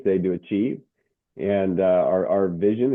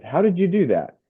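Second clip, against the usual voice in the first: amazed question; 9 semitones higher than usual. Retold or imitated. Gentleman retold, amazed.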